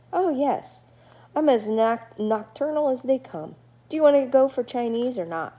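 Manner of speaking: a friendly bat gabbing at his new neighbor; chatty bat Murray. Acted. Murray answers eagerly.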